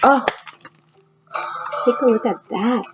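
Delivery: ordinary talk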